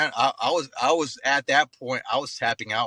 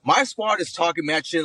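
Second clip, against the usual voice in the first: usual speaking voice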